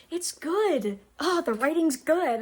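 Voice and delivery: gushing about the book, can't sit still; antsy excitement. Real, not acted. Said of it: "I'm antsy".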